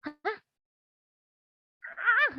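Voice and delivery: high, loud startled cry; a retold friend caught off guard; mock shocked exclamation; startled gasp.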